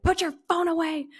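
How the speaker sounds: high, stern mom nagging. Retold or imitated. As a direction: scold like a strict mother. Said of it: "I turned into my mother".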